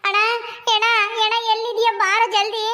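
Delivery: high-pitched call for a brother. Acted. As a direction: call out to someone out of sight. Calling for absent brother.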